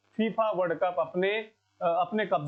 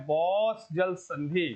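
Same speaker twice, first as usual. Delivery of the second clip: slow, emphatic answer; much slower than usual. Real, not acted. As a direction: draw out boss जल संधि slowly, word by word. Teacher stresses the key answer.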